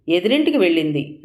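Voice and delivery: plain reading voice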